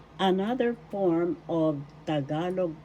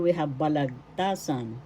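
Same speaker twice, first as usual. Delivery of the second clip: ordinary talk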